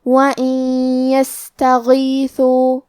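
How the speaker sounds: وَإِن يَسْتَغِيثُوا slow, nun merged into يا with a held nasal hum; nasal merge recitation. Shown as a demonstration. Teacher demonstrating وَإِن يَسْتَغِيثُوا, slow recitation. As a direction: chant وَإِن يَسْتَغِيثُوا with ghunnah. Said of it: "غنہ اور تشدید کے ساتھ ادا کریں".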